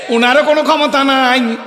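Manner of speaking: sudden high shout to the crowd. Real, not acted. Preacher rallying the crowd, shouted high. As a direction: burst out loud and high on the line.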